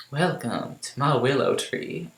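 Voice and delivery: slow, soft Southern drawl; put-on Southern belle accent. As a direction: welcome them like a Southern belle. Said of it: "like, a nice Southern belle".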